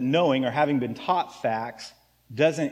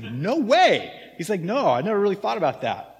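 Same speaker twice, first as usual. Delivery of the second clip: imitating son's surprise; 5 semitones higher, 3 dB louder than usual.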